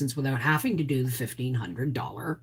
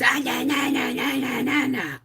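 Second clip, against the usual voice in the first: mock yelling son; 8 semitones higher than usual. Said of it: "he's yelling at me".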